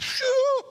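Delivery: sharp high exclamation. Retold or imitated. Mimics shock reaction.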